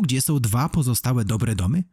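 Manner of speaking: usual speaking voice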